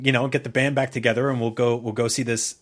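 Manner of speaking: usual speaking voice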